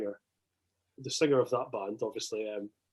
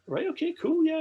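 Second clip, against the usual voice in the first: high, jittery starstruck reply; nervous squeak. Retold or imitated. Nervous fan answering [name], high squeak. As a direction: squeak it out trying to sound cool.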